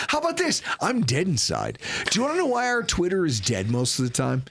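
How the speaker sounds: ordinary talk, nothing marked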